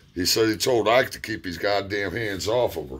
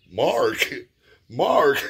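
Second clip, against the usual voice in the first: startled; 7 semitones higher, 4 dB louder than usual. Retold or imitated. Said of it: "my brain's like".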